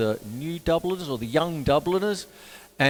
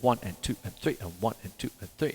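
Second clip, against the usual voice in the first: counting three four; 4 semitones lower, 8 dB quieter, faster than usual. Shown as a demonstration. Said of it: "Don't get it mixed up with three four".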